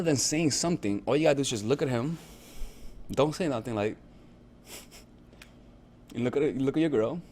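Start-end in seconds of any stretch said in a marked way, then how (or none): none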